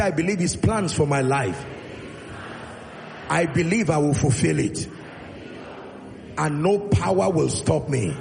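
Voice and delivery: loud, emphatic declaration of faith; with conviction. Shown as a demonstration. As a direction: proclaim it loudly so the congregation echoes it. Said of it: "say it like you believe".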